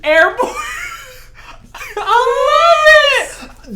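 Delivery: very high, loud laughing shout; overjoyed laughing cry; ecstatic shriek. Real, not acted. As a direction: shriek with joy. Finds gifts in package.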